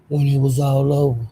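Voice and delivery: lightly mimicked subdued voice, level monotone; imitating his deflated talk. Telling viewers how he sounded when beaten, mildly mimicked subdued talk. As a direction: slip into a flat imitation of him. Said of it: "He was talking like this".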